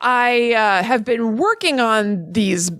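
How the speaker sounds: halting, drawn-out delivery; slow down awkwardly on the unfamiliar term; out-of-touch writer. Acted. Host mimicking an out-of-touch writer to co-host, halting and stilted. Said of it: "who's out of touch with".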